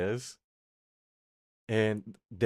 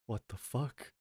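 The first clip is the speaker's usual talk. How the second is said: much softer, under-the-breath disbelief; muttered aside; 11 dB quieter, slower than usual. Retold or imitated. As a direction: mutter it to yourself, baffled. Inner reaction holding the chocolates.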